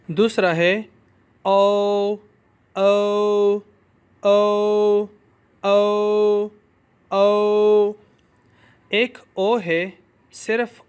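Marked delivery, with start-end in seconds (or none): 1.4-7.9 او as a long glide from o into u; ou diphthong. Shown as a demonstration. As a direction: let او slide to a closing u. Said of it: "او یو سے مختلف ہے". (او کو او یو کی طرح کھینچ کر)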